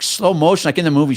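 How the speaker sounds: ordinary delivery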